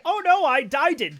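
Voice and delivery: high, loud cartoonish dismay; mock a silly "oh no" moment, loud and high; silly mock alarm. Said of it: "kind of silly stuff".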